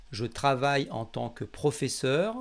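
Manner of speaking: ordinary talk, nothing marked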